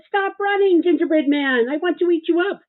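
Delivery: hungry dog. Acted. Dog demanding the gingerbread man stop, high and loud. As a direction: bark out the order to the cookie.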